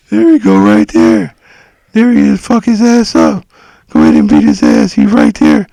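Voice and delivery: loud, menacing alter-ego voice egging on a beating; creepy other voice. Retold or imitated. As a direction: growl it out loud like a second personality urging violence. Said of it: "That's the voice he would use".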